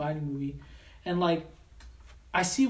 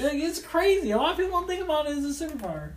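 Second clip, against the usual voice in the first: excited; 10 semitones higher, 4 dB louder than usual. Real, not acted. Excited claim about superpower.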